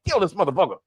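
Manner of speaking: hushed, conspiratorial imitation; mock secret order. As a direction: slip it in under the fake scolding. Acted secret order after public scolding.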